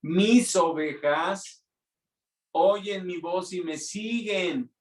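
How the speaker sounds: slow, stretched-out scripture proclamation; quoting the Lord. Acted. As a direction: proclaim it slowly, pitch lifting as it goes. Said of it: "¿Qué dijo el Señor?".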